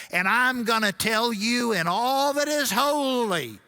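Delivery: high, loud Southern preacher accent, mimicking; mock the pastor in a Southern preacher accent; ramping up a Southern preacher accent for the crowd; pastor's Southern preacher accent. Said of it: "I'm gonna try to do his accent".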